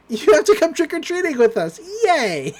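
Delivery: put-on high, bouncy, thrilled girl; excited squeal. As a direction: squeal it like a kid who loves danger. Mabel cheerfully agreeing to danger.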